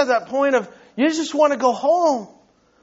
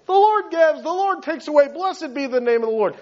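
higher-pitched, tossed-off pious recital; glib recitation; 5 semitones higher than usual. Retold or imitated. Preacher mimicking his own easy piety, glib. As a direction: rattle it off like easy words. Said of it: "not that powerful coming from maybe my lips".

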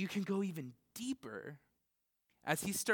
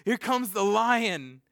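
eager announcement; 5 semitones higher, 10 dB louder than usual. Retold or imitated. John eagerly announcing the coming Lion. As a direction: herald the lion's arrival. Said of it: "he's like".

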